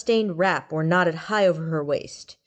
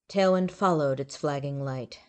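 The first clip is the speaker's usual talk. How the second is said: ordinary delivery, nothing marked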